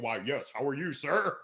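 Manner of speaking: stiff, formal office robot; mock-corporate robotic courtesy; robot, super official. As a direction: greet with exaggerated official politeness. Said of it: "I was like a robot, super official".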